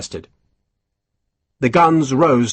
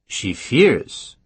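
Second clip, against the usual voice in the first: a mild questioning echo of her fear; puzzled echo. A narrator's reading. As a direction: repeat it as a curious question. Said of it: "Soult asked".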